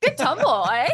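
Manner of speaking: hammed-up child voice laughing; laughing imitation. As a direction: giggle out the kid's catchphrase. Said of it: "'Cause I say".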